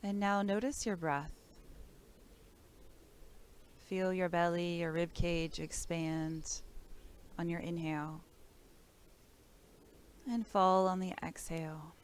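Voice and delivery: calm, measured body-scan voice with long pauses; guided meditation. Real, not acted. Teacher guides listeners through breath and body awareness, calm meditation voice.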